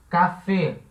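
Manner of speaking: ordinary talk, nothing marked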